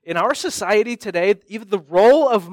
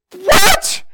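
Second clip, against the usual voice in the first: high, loud jolted-awake rise; startled what; 13 semitones higher, 6 dB louder than usual. Retold or imitated. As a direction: snap awake in shock. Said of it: "I'm like".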